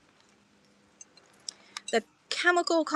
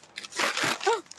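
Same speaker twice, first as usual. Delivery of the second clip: gasp; 9 semitones higher, 3 dB quieter than usual. Real, not acted. Nearly drops the pottery.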